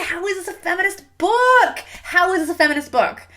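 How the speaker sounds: high-pitched incredulous ranting; exasperated disbelief. Real, not acted. Exasperated rant about the book.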